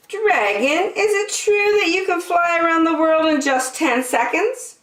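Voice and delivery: high, coaxing question; syrupy flattery; sweet high princess. Acted. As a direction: lure the dragon into showing off. Princess tricking the dragon.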